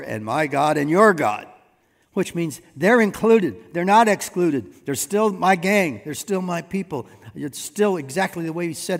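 building, emphatic pulpit reading; emphatic preaching. Acted. Reads and expounds Jesus' words forcefully.

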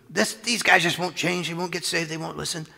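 high-pitched venting about people; mock exasperated complaint. Voices complaint about stubborn people.

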